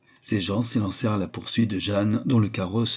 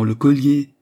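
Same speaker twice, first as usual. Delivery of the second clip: higher, louder dogged demand; insistent repeating; 4 semitones higher, 7 dB louder, slower than usual. A narrator's reading. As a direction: hammer out the same demand. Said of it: "répétaient les deux marchands".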